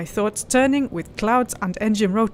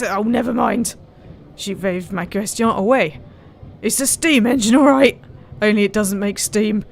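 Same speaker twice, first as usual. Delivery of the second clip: bad Yorkshire accent; 4 dB louder than usual. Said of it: "which is not remotely Yorkshire".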